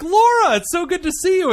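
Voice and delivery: very high, delighted gush; gushing greeting. Retold or imitated. Greeting his childhood friend, very high gushing delight. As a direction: gush back like long-lost friends. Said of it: "I'm like".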